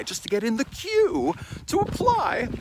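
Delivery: high, exasperated. Real, not acted. Exasperated about the permit rush.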